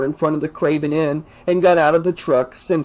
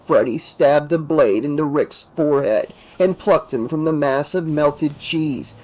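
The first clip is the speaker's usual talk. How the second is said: usual speaking voice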